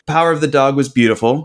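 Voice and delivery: ordinary talk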